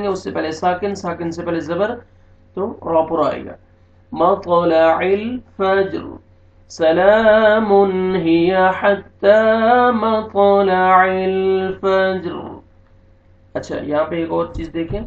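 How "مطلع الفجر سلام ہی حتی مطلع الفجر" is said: slow, melodic recitation, somewhat louder; Quran recitation. Shown as a demonstration. Teacher reciting the verse end for students, slow tilawat. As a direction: stretch it out as tilawat.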